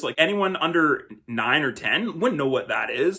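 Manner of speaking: ordinary talk, nothing marked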